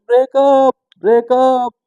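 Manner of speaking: high, loud call of breakup from downstairs; calling breakup twice, pitched high and loud; girlfriend calling out. Retold or imitated. Girlfriend calling from below stairs.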